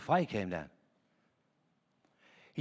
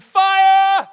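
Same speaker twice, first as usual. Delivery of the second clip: high, full-voice shout, much louder; shouting fire; 12 semitones higher, 12 dB louder than usual. Retold or imitated. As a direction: throw it out desperate. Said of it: "I shouted".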